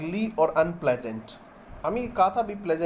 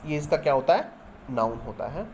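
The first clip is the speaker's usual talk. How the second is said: usual speaking voice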